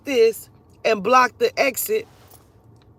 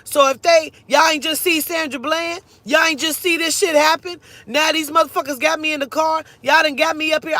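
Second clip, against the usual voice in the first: loud, higher outraged appeal; retold screaming; 5 semitones higher, 4 dB louder than usual. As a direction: re-enact yelling to bystanders, voice raised. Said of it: "that's why I started screaming like".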